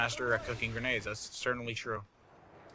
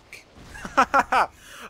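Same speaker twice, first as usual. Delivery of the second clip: laugh; 9 semitones higher, 7 dB louder than usual. Real, not acted. Laughs after dying.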